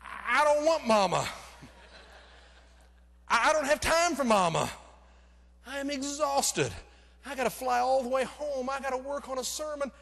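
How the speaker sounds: high, loud, desperate whining protest; exasperated inner protest. Retold or imitated. Exhausted preacher pleading not to escort Mama, high loud whine.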